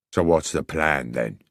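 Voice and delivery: gruff voice. Acted. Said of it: "asked the gruff voice".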